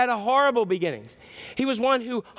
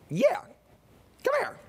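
very high, squeaky parrot reply; high bird-voiced summons; talking parrot. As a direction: pitch it way up as a parrot. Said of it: "It's the parrot".

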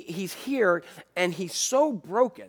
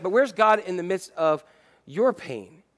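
normal talking voice

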